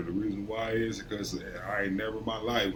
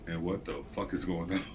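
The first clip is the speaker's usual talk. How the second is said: much softer, lower, under-the-breath shock; muttered disbelief; 6 semitones lower, 9 dB quieter than usual. Retold or imitated. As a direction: quietly realize who you hired. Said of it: "I'm like".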